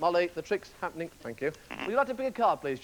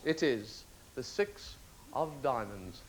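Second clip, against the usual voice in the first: low, slow, quiet reveal; understated big finish; slow triumphant reveal; 10 semitones lower, 9 dB quieter, much slower than usual. Acted. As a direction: draw out the reveal softly. Trick reveal.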